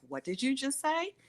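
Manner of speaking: a high, questioning challenge without extra volume; retold incredulous challenge. Teller voices her mom confronting the anesthesiologist, high incredulous challenge.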